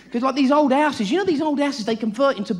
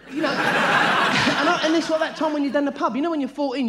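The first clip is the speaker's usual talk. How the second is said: normal talking voice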